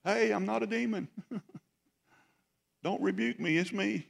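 high, soft voice; gently hushing frightened friends, pitched high; soft, high-pitched mock reassurance; Jesus reassuring the disciples. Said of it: "Jesus is like".

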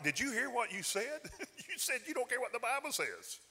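incredulous retort. Retold or imitated. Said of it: "I'm like".

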